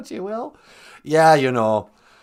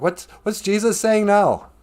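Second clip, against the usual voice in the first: high, louder mock question; imitated straining listener; 9 semitones higher, 3 dB louder than usual. Man at the back of the Sermon on the Mount, imitated puzzlement. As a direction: call it out as someone who can't hear. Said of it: "He's like".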